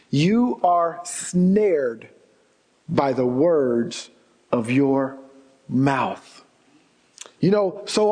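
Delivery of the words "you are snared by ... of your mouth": very slow, lower, each phrase set apart; drawn-out solemn preacher emphasis; slow emphatic repeat. Shown as a demonstration. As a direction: let every word of "You are snared by the words of your mouth" land slowly, pausing between phrases. Repeats the verse slowly for weight.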